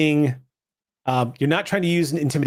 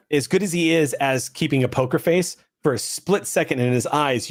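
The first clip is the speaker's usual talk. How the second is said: usual speaking voice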